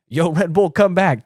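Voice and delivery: mock calling out. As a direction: pretend to summon someone back. Acted calling out to the bull.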